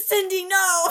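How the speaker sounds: high, loud laughing plea; dramatic plea. Pretend. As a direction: cry out like a movie farewell.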